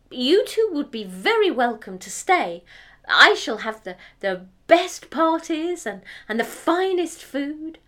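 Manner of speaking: high, loud haughty princess; cartoon princess. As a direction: proclaim it like a spoiled princess. Eldest girl voiced again.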